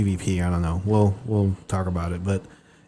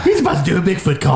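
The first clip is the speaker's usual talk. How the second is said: higher, louder and laughing; excited; 7 semitones higher, 4 dB louder than usual. Shown as a demonstration. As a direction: repeat it with rising glee.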